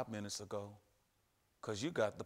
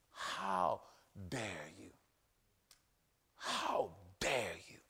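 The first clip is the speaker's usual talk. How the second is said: devil's taunt; 5 semitones lower, much slower than usual. Acted. Preacher voicing the devil taunting the church, low and whispered. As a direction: let the devil hiss it low and slow. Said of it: "what the devil is saying".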